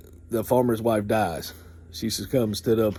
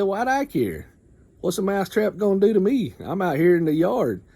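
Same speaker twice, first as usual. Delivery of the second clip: higher chicken voice, brushing him off; a chicken who can't be bothered; dismissive chicken; 8 semitones higher than usual. Retold or imitated. Chicken dismissing the mouse, higher scoffing voice.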